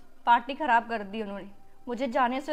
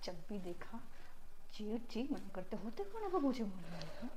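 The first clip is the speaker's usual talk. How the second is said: low, very soft grumbling to herself; hushed, low-pitched private complaint; muttering; 5 semitones lower, 14 dB quieter than usual. Acted. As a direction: mutter resentfully under your breath. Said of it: "क्या बड़बड़ा रही हो?".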